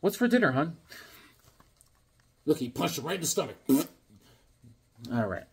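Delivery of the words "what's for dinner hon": high, casual mock husband; change the subject in a higher, innocent voice; put-on domestic nonchalance; sheepish husband. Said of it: "and the guy's like".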